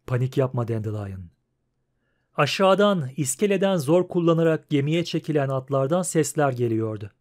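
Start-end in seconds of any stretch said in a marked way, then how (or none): 0.1-1.3 low, unruffled reply; Geralt, calm. A narrator's reading. Witcher telling his friend not to panic, calm and low. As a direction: settle him down in a low voice.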